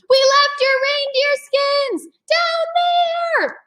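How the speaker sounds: high, slow, drawn-out call. A narrator's reading. Annie calls to the family below, long drawn-out call. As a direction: sing it out across the distance.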